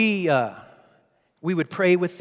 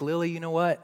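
gentle and much quieter than the outburst; tender, tearful dad; 4 dB quieter, faster than usual. Retold or imitated. Dad holding his crying daughter close, tender. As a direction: softly open up to your child. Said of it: "now I'm crying".

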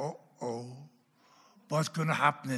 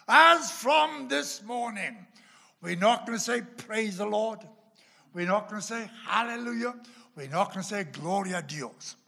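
pastor's announcement; 5 semitones higher, 6 dB louder than usual. Retold or imitated. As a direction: proclaim it to seven thousand people.

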